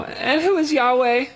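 a touch of royal disdain; mock haughty Pharaoh. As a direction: Pharaoh scoffing at the request. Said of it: "retorted Pharaoh".

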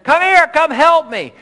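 high, loud shout to a friend; urgent call for help. Acted. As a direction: yell out for backup like a soldier in trouble. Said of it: "you need help and you call to your friend".

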